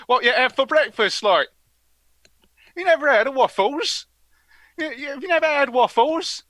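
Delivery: high-pitched incredulous Cockney questioning; be amazed they don't know it; mock disbelief. Asking someone who never had waffles, high and incredulous.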